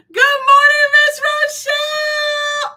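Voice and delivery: high, loud, drawn-out good morning; excited shouted greeting. Real, not acted. Host greeting a viewer by name with a high, loud call. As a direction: sing out the name across the room.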